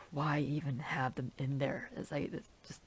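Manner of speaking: breathy whisper of disbelief; whispered aside. Real, not acted. A fan whispering frustration at the movie's choices. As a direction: whisper it to yourself, exasperated.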